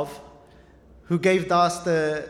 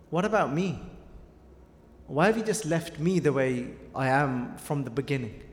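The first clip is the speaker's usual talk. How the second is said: put-on softer, sinking plea of an old companion; innocent plea; 5 semitones lower, 6 dB quieter than usual. Old Mardana asks the Guru why he's left behind, quiet and hurt. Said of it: "So innocent".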